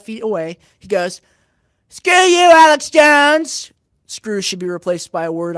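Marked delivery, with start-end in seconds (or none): 1.9-3.7 high, loud mock shout; imitated heckling yell. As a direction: yell it down the aisle, drawing out the end. Imitating heckler yelling from far away.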